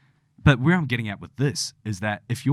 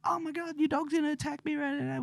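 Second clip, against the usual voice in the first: mock panicked screaming; 6 dB quieter than usual. Panicking family fearing the dog will attack, mock scream. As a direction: mimic someone freaking out in a tiny strangled scream. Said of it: "yell and scream and go".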